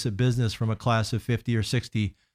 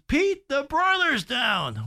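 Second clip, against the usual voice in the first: high, slow, drawn-out alarm; high, slow coworker's voice breaking bad news; urgent coworker on the phone; 13 semitones higher, much slower than usual. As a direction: stretch it out like a panicked colleague.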